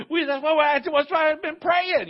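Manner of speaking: playful told-you-so, high and loud; excited. Retold or imitated. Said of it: "And we're like".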